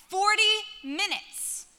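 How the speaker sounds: ordinary talk, nothing marked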